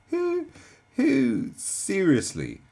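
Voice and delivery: high, loud, drawn-out laughing disbelief; incredulous laughter. Real, not acted. Reactor asks who says comrade nowadays, incredulous laughter. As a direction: struggle to ask it while cracking up.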